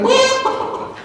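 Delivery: sudden high startled "Whoa"; imitating the man. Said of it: "he went".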